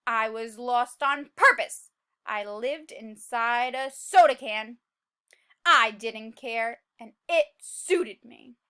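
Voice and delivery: low, slow, brooding read-aloud; gloomy narration. A narrator's reading. Seed describing his lonely bad life to listeners, gloomy narration. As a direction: read it like a sulky loner.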